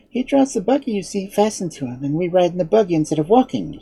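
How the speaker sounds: put-on high-pitched girl, quickening as she explains; Dorothy. A narrator's reading. Girl explaining the horse to unseen man, high and eager. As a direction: explain in a young girl's high voice.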